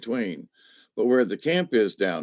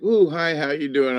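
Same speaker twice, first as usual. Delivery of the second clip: voicing the excited dog; 5 semitones higher than usual. A dog in his lap greeting him in an eager mock voice. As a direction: play the little dog happily saying hello.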